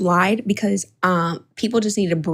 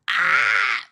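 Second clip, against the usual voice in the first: mock shriek; 9 semitones higher than usual. Mock horror at woman's voice.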